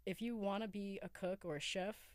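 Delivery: usual speaking voice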